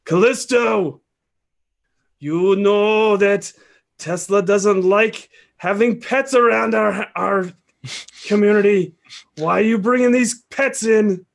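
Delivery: dog creature hollering a complaint to Callisto; yelling. Acted. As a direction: shout it over to Callisto from the van. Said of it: "he yells over to Callisto".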